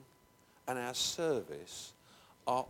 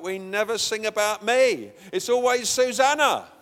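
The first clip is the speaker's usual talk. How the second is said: put-on complaining girl; 7 semitones higher, 13 dB louder than usual. Girl Kirsty complaining, voiced.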